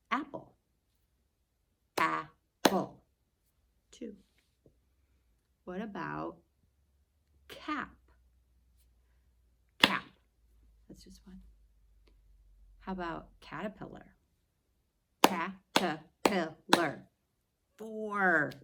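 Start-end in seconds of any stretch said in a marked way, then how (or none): 2.0-3.0 Apple louder, ap-ple; clapping Apple with the class; clapped syllables. Shown as a demonstration. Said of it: "Let's clap out". 15.2-17.0 Caterpillar slow and loud, ca-ter-pil-lar; clapping Caterpillar for the class; clapped syllables. Shown as a demonstration. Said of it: "Let's clap out".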